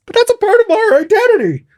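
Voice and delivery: very high, squeaky mock-outraged voice; freaking-out Canadian. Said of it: "Anybody who is Canadian here is just freaking out".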